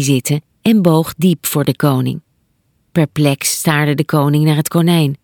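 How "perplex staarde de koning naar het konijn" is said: ordinary delivery, nothing marked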